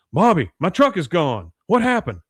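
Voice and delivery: loud, high, alarmed father; mock alarm. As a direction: call out in acted dismay. Father discovers truck gone, retold.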